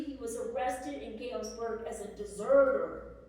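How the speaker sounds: ordinary talk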